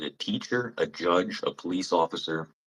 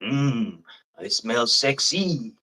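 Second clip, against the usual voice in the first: slow, higher, smug put-on voice; mocking vain Frenchman; 6 semitones higher, slower than usual. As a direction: ham up a self-satisfied sniff of his own scent. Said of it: "they think that's like".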